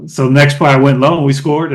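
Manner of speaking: ordinary talk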